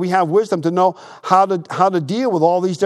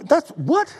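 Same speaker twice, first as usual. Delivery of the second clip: high-pitched baffled double take; puzzled "what?" shooting upward; 12 semitones higher than usual. Retold or imitated. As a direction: stop short, then a disbelieving rising question. Acting out his baffled reply.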